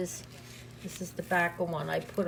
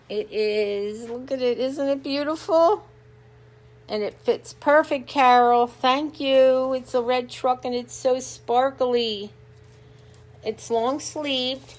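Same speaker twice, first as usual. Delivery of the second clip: louder, higher, thrilled about the shirt; excited; 4 semitones higher, 8 dB louder than usual. Real, not acted. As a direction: show off the gift with delight. Excited showing off new shirt.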